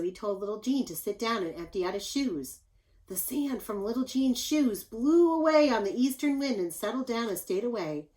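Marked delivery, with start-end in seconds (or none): none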